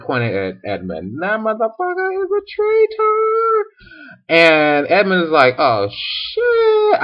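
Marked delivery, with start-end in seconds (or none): 1.2-3.7 very high, put-on witch screech; shrieking witch accusation. Witch repeats accusation, escalated. 5.6-7.0 very high, put-on squeal; hammed-up high-pitched alarm; mock panic. Acted Edmund panics at accusation.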